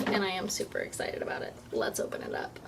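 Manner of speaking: quiet excited whisper; hushed excitement. Real, not acted. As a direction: whisper it as the box opens.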